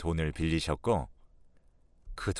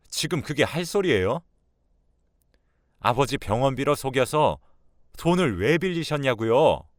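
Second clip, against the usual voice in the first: raised, accusing voice; exasperated, higher-pitched rebuke; indignant son; 5 semitones higher, 3 dB louder than usual. Acted. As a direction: demand an explanation from mother.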